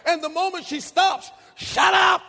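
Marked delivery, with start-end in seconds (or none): 1.5-2.2 loud, higher bark; mom yelling. Retold or imitated. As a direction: snap at the child to stop crying. Mother silencing crying child.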